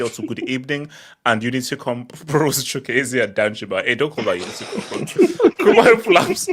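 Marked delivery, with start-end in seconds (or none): 3.8-6.5 real laughter through the words; laughing objection. Real, not acted. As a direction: protest while cracking up. Laughing while objecting to a joke.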